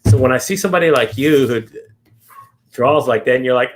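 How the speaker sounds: ordinary talk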